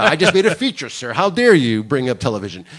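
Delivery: pompous mock indignation; mock indignant. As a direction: play the offended feature director, hammed up. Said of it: "I had that thing in my head that I go".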